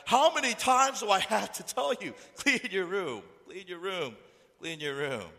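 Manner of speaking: loud, high scolding, easing as it repeats; exasperated parent. Acted. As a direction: imitate a parent fed up with repeating. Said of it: "mom and dad is always telling you".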